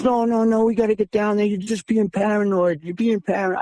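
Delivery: high-pitched dismissive insistence; retold insistent protest. Friend's side of acted quarrel.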